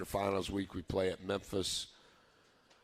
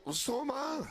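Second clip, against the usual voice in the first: half-laughing, pitched up high on the comeback; mock indignant comeback. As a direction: toss it back with a grin, pitched up. Coach retells his own comeback.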